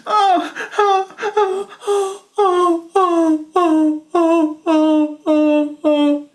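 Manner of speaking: high, loud crying wail; child wailing. Acted. Little boy wailing after mommy's pat.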